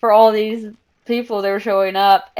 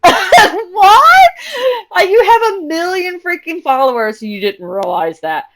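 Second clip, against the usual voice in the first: high, loud laughing disbelief; incredulous; 10 semitones higher, 6 dB louder, slower than usual. Retold or imitated. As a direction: shout in amused disbelief. Reacting to his modesty.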